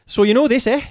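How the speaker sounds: high, loud attempt at Irish; attempted Irish accent. Player attempting a film quote, high Irish accent. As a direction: pitch it up into a bad leprechaun Irish. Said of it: "trying to sound like a leprechaun".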